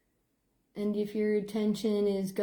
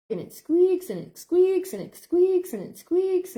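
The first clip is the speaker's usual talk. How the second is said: higher and louder, hammering each squeaks; insistent repetition; 9 semitones higher, 7 dB louder than usual. Retold or imitated. Telling coworkers about the door, insistent rhythmic repetition. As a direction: beat out every squeaks like a nagging hinge.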